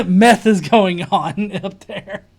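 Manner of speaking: slow words through laughter; laughing. Real, not acted. Laughing at own joke.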